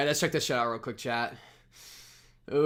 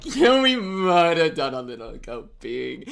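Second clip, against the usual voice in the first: sheepish admission; 3 semitones higher, 6 dB louder, slower than usual. Shown as a demonstration.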